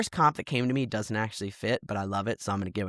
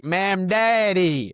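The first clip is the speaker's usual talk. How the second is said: drawn-out, loud loving mispronunciation; fond, booming mock mispronouncing; Al Sharpton impression; 6 semitones higher, 5 dB louder, much slower than usual. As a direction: stretch the name out high with affection. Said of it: "Al Sharpton said it with love".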